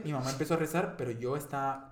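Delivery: ordinary talk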